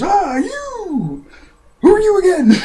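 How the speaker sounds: very high, loud falsetto squeal; hammed-up squeaky cartoon girl; mock excited girl. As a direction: squeal in recognition, then loudly blank on who it is. Voices Ariel recognizing them.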